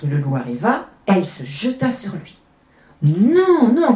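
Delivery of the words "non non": loud, high drawn protest; high, loud children's objection; crying out. A narrator's reading. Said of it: "crient les enfants".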